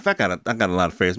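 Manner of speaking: ordinary delivery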